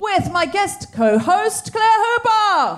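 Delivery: slow, loud, rising host announcement; announcer. Acted. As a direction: announce the guest like a game show.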